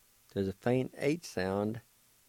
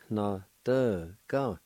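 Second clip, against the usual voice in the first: syllable-by-syllable demo; slower than usual. Shown as a demonstration. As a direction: sound out "Na, de, ga" piece by piece. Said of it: "It's pronounced like this".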